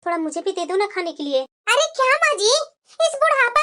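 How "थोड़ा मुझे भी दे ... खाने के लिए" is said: put-on lower, soft old voice pleading for food; an old woman softly begging for a treat; elderly mother-in-law coaxing. Old mother-in-law asking her daughter-in-law for chole bhature, lower and soft.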